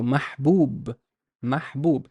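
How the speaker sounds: "mahbub" slowly, with long oo in the last syllable; careful "mahbub", breathed h, then long oo; long vowel demo. Shown as a demonstration. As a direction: stretch the second syllable of "mahbub". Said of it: "this waw is a long vowel".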